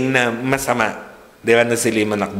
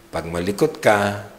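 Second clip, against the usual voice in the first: quiet warning; 9 semitones lower, 8 dB quieter than usual. Retold or imitated. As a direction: hush a warning of what happens at home. Parent's quiet threat to child. (pabulong na pananakot ng magulang)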